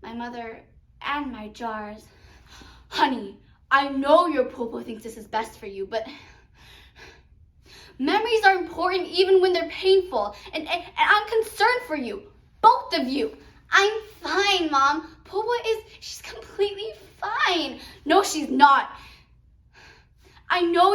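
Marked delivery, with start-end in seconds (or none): 2.9-13.4 put-on loud, worried mother confronting her child; concerned mother. Mother confronts her daughter over the jars, loudly concerned. 13.7-17.8 high, loud protest; defensive daughter. Acted. Daughter insists to her mother that all is well, loudly defensive.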